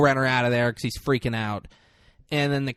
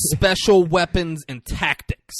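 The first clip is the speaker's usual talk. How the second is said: "Special Weapons and Tactics" slow, loud and higher; emphatic reprimand; 5 semitones higher, 6 dB louder, slower than usual. Retold or imitated. Boss scolding his squad, "Special Weapons and Tactics" in emphatic reprimand.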